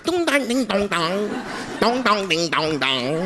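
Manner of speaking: high singsong nonsense syllables; sing-song gibberish imitation; mock Chinese chatter. Mock Chinese speech sounds.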